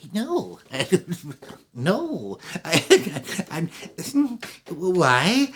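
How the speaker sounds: high, halting, giggly refusals; nervous little man. Acted. Little man thinks she's coming on, nervous. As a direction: stammer it out with nervous little laughs. Shy clerk fears she's flirting.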